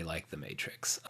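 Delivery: sheepish whispered confession; whispering. Real, not acted. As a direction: admit it under your breath. Sheepish admission.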